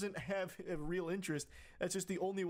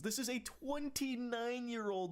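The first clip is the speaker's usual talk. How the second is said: exasperated; 6 semitones higher, 13 dB louder, slower than usual. Real, not acted. Exasperated emphasis.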